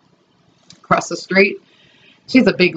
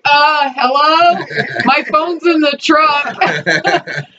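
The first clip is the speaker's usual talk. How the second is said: slow, higher, sheepish calling out; stranded and helpless; 7 semitones higher, much slower than usual. Retold or imitated. Stuck hanging from the line, slow sheepish calling for help. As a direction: drag it out, half-laughing and helpless.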